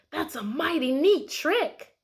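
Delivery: drawn out like you're secretly impressed; the dog thinks about the duck on a bike; impressed dog thought. Acted. Dog's thought in story.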